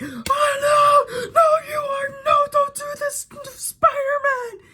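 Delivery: very high squeaky mock wail; mock outraged fans. Most people hearing of the Tobey Maguire casting, squeaky mock dismay. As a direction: mimic protesting fans in a hammed-up falsetto. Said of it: "most people went like".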